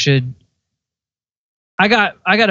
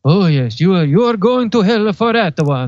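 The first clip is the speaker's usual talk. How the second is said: put-on raspy old Italian-sounding pontiff; the Pope; 2 semitones higher than usual. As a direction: pass judgment as an old Argentinian with a mock Italian accent. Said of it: "That's my, that's my old Argentinian".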